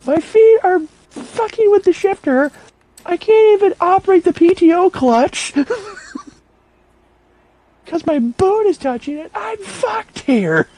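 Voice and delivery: high, strained whining about being stuck; whiny complaint. Retold or imitated. Buddy complaining to friend about the cramped tractor, high whine. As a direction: complain like a big guy crammed in a tiny seat. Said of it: "He goes".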